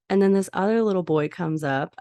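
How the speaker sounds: ordinary delivery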